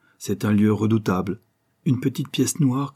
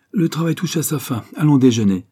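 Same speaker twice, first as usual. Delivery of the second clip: sudden raised announcement, louder at the end; abrupt, louder call to break off; 6 semitones higher, 5 dB louder than usual. A narrator's reading. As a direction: break in briskly, pitched up. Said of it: "me dit brusquement Finucane".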